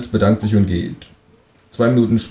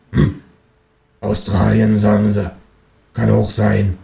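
grumbling Berlin neighbour; downstairs neighbour; slower than usual. Acted. Neighbour complains about foreigners in the house, grumbling in Berlin dialect. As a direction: grumble as the bigoted woman downstairs. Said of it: "fragt sie".

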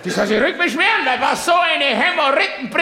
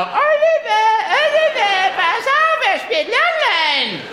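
much higher, screeching mock mother's cry; shrill tennis mother; 12 semitones higher than usual. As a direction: shriek her son's name like a frantic tennis mom. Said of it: "Aber diese Frau". (hohe, kreischende Tennismutter, parodiert)

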